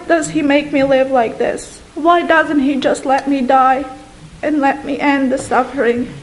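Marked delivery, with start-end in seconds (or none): none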